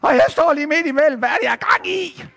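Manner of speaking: high, loud alarmed voice; acted rising alarm, almost squealed; sudden panic. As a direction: blurt it as panic takes over. Peter panics between boat and Jesus.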